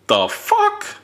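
very high shocked "the fuck"; incredulous squeal. Real, not acted. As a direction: squeak it out in disbelief. Incredulous outburst.

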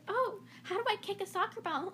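high, soft mock-clueless girl; put-on helpless voice; playing stupid. Girl at the beach playing stupid, mock innocent. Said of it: "she's playing stupid".

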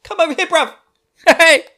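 high-pitched mock Essex geezer; hammed-up Essex lad hailing a mate; squeaky, matey Essex banter; Essex accent. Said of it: "where everyone's from Essex".

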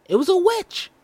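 very high shrill insistence; shrieking. Acted. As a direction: shriek that it was a witch.

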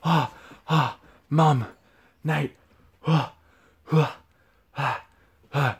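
hammed-up out of breath gasps; mock panting. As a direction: fake breathless panting oh's. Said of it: "opened the door and he's like".

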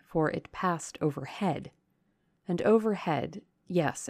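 ordinary delivery, nothing marked

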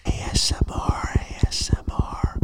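slow, imitated mic whisper; ASMR whisper. ASMR person brought in to break prisoner, whispering. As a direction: whisper slowly as a mock torture. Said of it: "someone in that was like".

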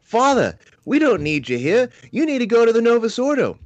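very high, loud mock New York complaint; New York accent. Parishioners turning the priest away, high mock New York accent. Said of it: "they say".

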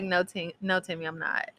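retold gentle answer, voice dropped; reassuring mother. Mother reassuring her son, retold.

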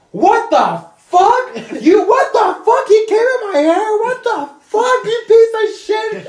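high, loud mock screech, dragged-out words; hammed-up shrieking fury; outraged screaming. Woman cursing the man who wronged her, outraged screaming.

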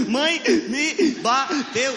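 very slow high sobbing syllables; hammed-up child sobbing; crying in syllables. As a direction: cry it out syllable by syllable. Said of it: "eu chorava em sílaba".

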